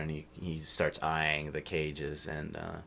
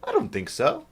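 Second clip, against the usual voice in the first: dim human voice; 10 dB louder than usual. Acted. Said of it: "he's like".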